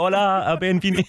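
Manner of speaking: giggly radio host greeting; Spanish radio trail. Pretend. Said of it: "I'll record you a trail in Spanish".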